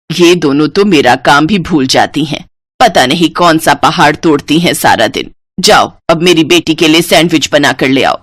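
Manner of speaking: put-on low-pitched older woman's scolding; complain, then order them off; grumbling mother-in-law. Mother-in-law scolds both daughters-in-law.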